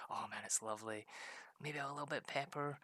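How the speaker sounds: ordinary talk, nothing marked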